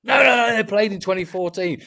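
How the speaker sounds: a pedantic fan pouncing on the error; indignant fan correction. Retold or imitated. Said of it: "we get ripped".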